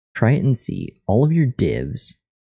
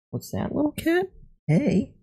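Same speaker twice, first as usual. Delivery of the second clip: much higher cooing voice; high pet voice; 5 dB quieter than usual. Real, not acted. Talking to the cat.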